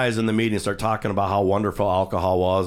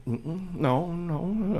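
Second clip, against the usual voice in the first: guilty denial; 8 semitones higher, 6 dB quieter, much slower than usual. Retold or imitated. Ben denies drinking to homeless man.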